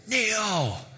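high mock villain command; Loki super-powered scream. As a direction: fling the word up high like a god demanding obedience. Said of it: "he screams out in his, like, super-powered volume voice".

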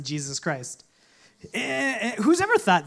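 normal talking voice

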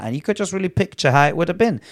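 ordinary talk, nothing marked

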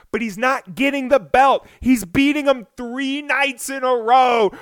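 ordinary talk

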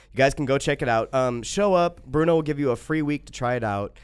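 ordinary talk, nothing marked

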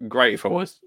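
ordinary talk

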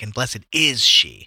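slow, with "is" punched out; odd stress on IS. Shown as a demonstration. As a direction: lean heavily and oddly on "is". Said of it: "these odd enunciations".

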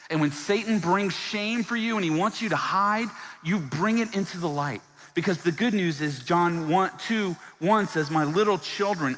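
loud, high, fiery preaching; full-force preaching. Acted. Pastor urging the congregation, loud fervent preaching. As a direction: preach it out over the room.

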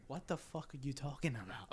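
hushed inner-voice disbelief; very soft retold thought; silent cursing. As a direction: curse softly, as if only thinking it. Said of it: "in my mind".

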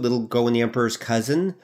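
ordinary talk

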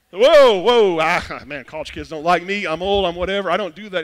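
loud whoa, then hurried backing off; mock alarm. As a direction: imitate someone recoiling from being volunteered. Church member backs off alarmed.

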